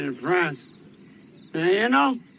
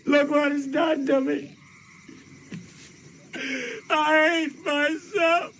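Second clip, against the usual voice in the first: much higher, crying out in self-loathing; pitched-up sob of self-hatred; breaking down crying; 12 semitones higher than usual. Acted. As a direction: let the voice crack into tears. Drunk soldier breaks down.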